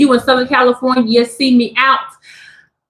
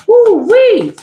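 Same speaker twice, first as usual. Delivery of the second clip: higher, louder whoop; excited woo-wee; 9 semitones higher, 5 dB louder than usual. Real, not acted. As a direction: let out a happy whoop. Excited exclamation.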